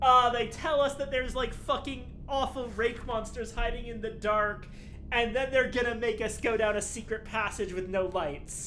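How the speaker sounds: loud, disbelieving complaint; exasperated. Real, not acted. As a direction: rant at the game in dread. Loud outraged complaint at the game.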